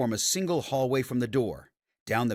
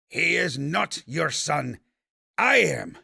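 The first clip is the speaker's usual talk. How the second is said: furious; 5 semitones higher, 4 dB louder, slower than usual. Acted. Fawkes screams in hatred.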